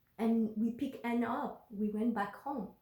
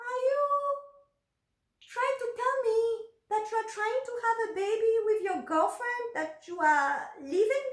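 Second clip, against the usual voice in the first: enraged; 10 semitones higher than usual. Retold or imitated. To her boyfriend about his girlfriend, outraged. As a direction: confront him, furious and disbelieving. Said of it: "I was enraged".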